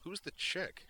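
put-on high, startled young guy; Zoner's voice. Zoner asks Duke about the unconscious woman, high and puzzled. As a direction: toss the question off high and quick.

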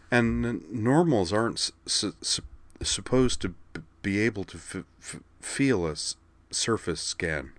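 broken-up, stuttering explanation; stammering. A narrator's reading. Kate explaining what normals can't feel, stammering. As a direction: get stuck on the first consonants.

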